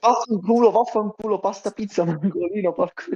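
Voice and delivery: mock Italian accent. Mock Italian pasta pizza chant.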